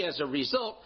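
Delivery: usual speaking voice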